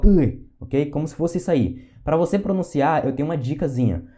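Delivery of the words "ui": sharp "ui" as if punched in the stomach; punched in the gut. Retold or imitated. Teacher imitates being punched in the stomach, sharp and loud. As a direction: yelp like a blow to the belly. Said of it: "você leva um soco na barriga e você fala".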